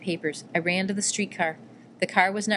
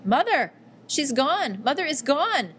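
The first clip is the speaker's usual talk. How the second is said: anguished scream, much higher and louder; 7 semitones higher, 4 dB louder, slower than usual. A narrator's reading. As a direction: scream it out in shock and grief. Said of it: "I screamed".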